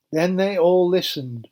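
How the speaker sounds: ordinary delivery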